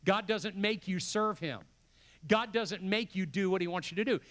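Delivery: usual speaking voice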